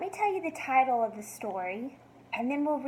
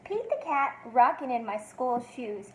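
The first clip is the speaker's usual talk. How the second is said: usual speaking voice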